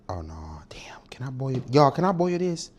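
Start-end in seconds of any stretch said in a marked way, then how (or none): 0.1-1.0 whispered dismay. Real, not acted. Cooking goes wrong on camera. 1.1-1.6 whispered question. Real, not acted. Unsure about the cooking.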